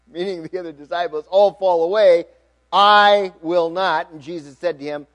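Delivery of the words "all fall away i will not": loud, drawn-out stress on I; emphatic boast. A narrator's reading. Peter vowing to Jesus he will not fall away, emphatic. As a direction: hit "I" hard and long, louder throughout.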